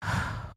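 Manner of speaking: deep sigh. Shown as a demonstration. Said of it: "take that deep breath and go".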